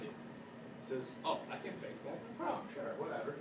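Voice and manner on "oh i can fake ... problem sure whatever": hushed, offhand aside; dismissive. Acted. Emperor agreeing to fake mourning, dismissive. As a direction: toss it off softly as no trouble. King brushes off mourning condition.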